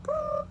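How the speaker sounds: very high short pop; playful boop. Retold or imitated. Teacher telling kids how the robin left, playful boop. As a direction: pop it out lightly like a tiny bird taking off. Robin flew away sound.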